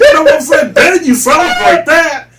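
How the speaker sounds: laughing rant. Real, not acted. Ranting about participation trophies.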